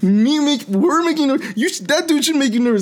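high-pitched mock indignation; joking protest. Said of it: "I was doing it in a joking way".

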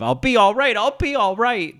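squeaky high mock-hysterical movie mother insisting she's fine; Nancy Kelly's Christine. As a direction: mimic the frantic, teary mother from the film, overdone. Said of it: "what a tour de force performance".

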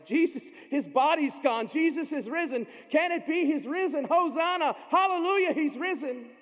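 high, loud, breathless shouting; joyful shout. Acted. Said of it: "they're shouting back and forth".

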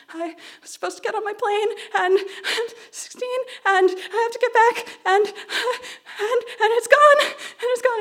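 high, breaking sob-talk; crying. Retold or imitated. Stranded teen at the airport telling a stranger, crying. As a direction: pour it out through tears, rising to a wail. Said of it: "start crying".

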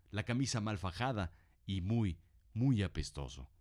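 normal talking voice

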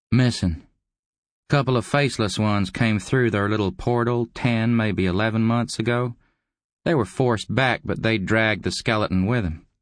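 high, matter-of-fact visitor; informant visitor. A narrator's reading.

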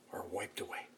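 soft whispered emphasis; hushed whisper. Real, not acted. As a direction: lean in and whisper the point. Preacher's intimate hushed point.

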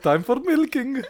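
high-pitched, lightly German milking call; slight German accent. Acted. Co-host showing how milking time is announced, slight German accent. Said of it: "you have to say it in, like, a slight German accent".

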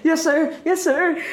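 high-pitched obedient squeak; mock submissive. Newcomer taking a scolding from a veteran, mock submissive. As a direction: play the cowed junior, voice way up.

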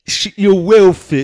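loud, slow, hammed-up lad voice; crude boyfriend. Boyfriend handing over a key ring, loud laddish voice. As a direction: belt it out like a crude suitor.

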